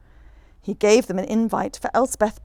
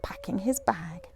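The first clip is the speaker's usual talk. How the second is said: soft unvoiced whisper; 9 dB quieter than usual. A narrator's reading. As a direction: whisper the end softly. Unvoiced whispered line end.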